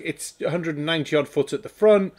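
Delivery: ordinary delivery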